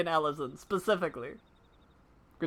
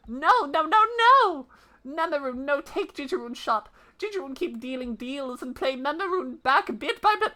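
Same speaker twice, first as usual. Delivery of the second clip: a little beast waving it off; protesting creature; 8 semitones higher than usual. Acted. Memorin refusing the shop, high creature protest.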